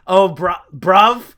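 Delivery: loud, hammed-up British slang, laughing; attempted British accent. Podcaster attempting the accent on co-host's request, mock British. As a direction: have a clumsy go at a British accent. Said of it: "Do the accent".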